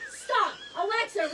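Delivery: ordinary talk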